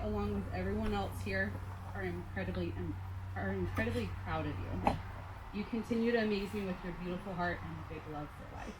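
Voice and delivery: ordinary talk, nothing marked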